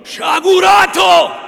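booming slow condemnation; thundering rebuke. A narrator's reading. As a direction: blast the word at him. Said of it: "gridò il padre Cristoforo".

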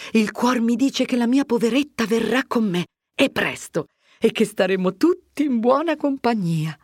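lightly voiced hope against grief; Agnese hopeful. Agnese stirring polenta, hoping Lucia will return.

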